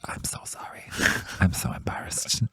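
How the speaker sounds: whispered, embarrassed apology; daughter's silent look voiced as a whisper. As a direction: breathe it, mortified. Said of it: "giving me a look of".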